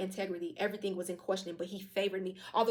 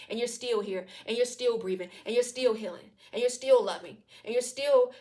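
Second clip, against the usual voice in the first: each line a bit louder, hammering on still; building preaching; 4 dB louder than usual. Real, not acted. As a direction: stack them up like a sermon, rising each time. Preaching list that builds in steps.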